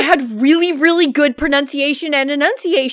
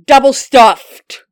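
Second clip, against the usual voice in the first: louder, slow, worked-up emphasis; passionate emphasis; 9 dB louder, much slower than usual. Real, not acted. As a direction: lean hard into the words. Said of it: "getting passionate about this".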